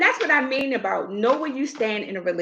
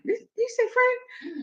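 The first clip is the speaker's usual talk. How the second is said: high, slow disbelieving question; comically affronted, pitched up; retold incredulity; 11 semitones higher, 4 dB quieter, slower than usual. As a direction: draw it out in high mock shock. Retells shock at word friend.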